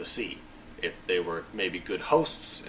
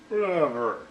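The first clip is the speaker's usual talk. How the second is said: higher-pitched scoffing woman, imitated; mock dismissive; 6 semitones higher, 5 dB louder, slower than usual. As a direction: brush it off in a put-on voice. Acted scoffing Sarah.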